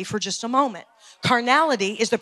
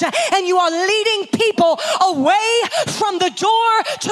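loud, higher, fiery revival preaching; preaching at full force; 9 semitones higher, 7 dB louder than usual. Acted. Preacher rousing the congregation against carnality, fiery shout. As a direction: build it up and hammer it home from the pulpit.

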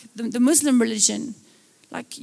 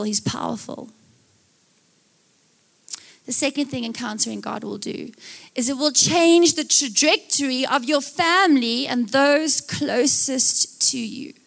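ordinary talk

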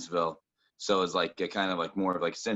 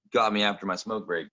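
normal talking voice

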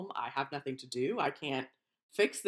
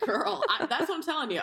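laughing high burst of excitement; giddy high-pitched laugh-talk; excited; 11 semitones higher, 4 dB louder than usual. Real, not acted. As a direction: squeal it out, laughing.

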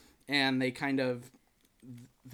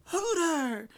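[name]. Retold or imitated. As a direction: imitate a high, sweet-voiced scolding. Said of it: "it's [name]'s voice, so he's like".

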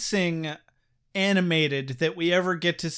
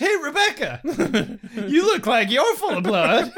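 high mock surprise; 6 semitones higher than usual. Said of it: "he starts to realize".

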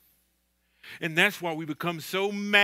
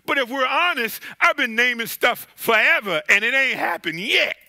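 louder, higher preaching cadence; full-force preaching; 7 semitones higher, 8 dB louder than usual. Real, not acted. Pastor presses the Sunday crowd about joy, fervent preaching. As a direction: drive it home from the pulpit.

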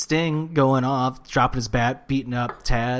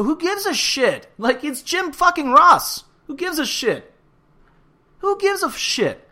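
exasperated outburst; 4 dB louder than usual. Real, not acted. Wrestling fan dismissing critics of Jim Ross, exasperated. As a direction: throw up your hands in exasperation, voice pitched way up.

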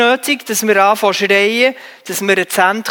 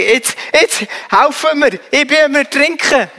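higher, slowed mock cry for help from the water; drowning swimmer's plea; 5 semitones higher than usual. A swimming instructor's drowning pupil finally calls for help, mock cry. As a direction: voice a sinking swimmer begging to be saved. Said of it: "bis sie mal sagen".

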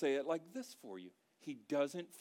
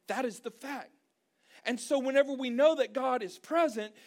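loud, raised-pitch sermon delivery; full-force preaching; 9 semitones higher, 10 dB louder than usual. Real, not acted. Preacher assuring congregation that God is present, full force. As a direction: drive each phrase home to the crowd.

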